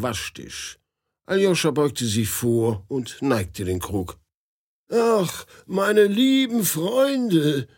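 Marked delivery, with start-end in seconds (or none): 4.9-7.7 put-on high, slow old man's voice. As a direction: drawl it like a fussy old gentleman. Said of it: "sagte plötzlich eine Stimme über seinem Kopf".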